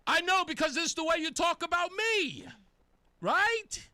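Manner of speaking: high, loud, exaggerated challenge; loud mocking. Real, not acted. Mocking how the anchor talks about him, loud high taunt. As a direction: throw it back at her, loud and high.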